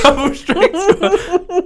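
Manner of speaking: high, drawn-out words through laughter; real helpless giggles breaking up the words; laughing hard. Real, not acted. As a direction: repeat it while cracking up. Helpless giggling over the squash mishap.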